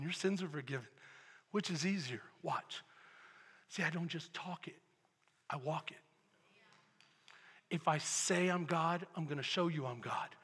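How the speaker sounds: low, soft voice of authority; quietly assured Jesus. Acted. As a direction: state it quietly, with pauses. Voicing Jesus answering his critics.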